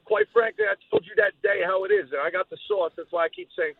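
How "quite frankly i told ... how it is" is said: high cocky insult; mock him with swagger; wrestling promo.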